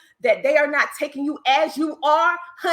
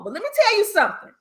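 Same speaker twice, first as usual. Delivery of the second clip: excited emphatic aside; 9 semitones higher, much faster than usual. Real, not acted. Same phrase, higher, louder.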